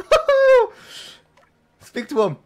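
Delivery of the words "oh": high loud surprised oh; high startled oh; surprised yelp. Real, not acted. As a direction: yelp at the screen.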